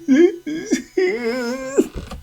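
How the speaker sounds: very high, thin mock wail; exaggerated high sobbing whine; mock crying. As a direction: imitate a man crying, high-pitched. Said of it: "He in there crying".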